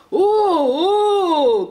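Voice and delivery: long, high, loud holler; shouted whoa. A narrator's reading. Santa trying to slow the runaway sleigh, shouting. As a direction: cry out to halt a runaway team. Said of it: "crie le Père Noël".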